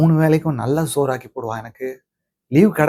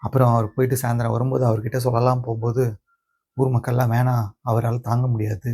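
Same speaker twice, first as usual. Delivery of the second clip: subdued sorrow; 4 dB quieter than usual. Acted. Grandson recounts burying grandmother and villagers stopping him, subdued sorrow. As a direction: hold the grief back, speaking quietly.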